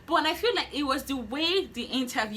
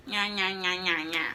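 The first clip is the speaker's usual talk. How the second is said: mocking nya-nya; 3 semitones lower than usual. Mocking babble of interviewers.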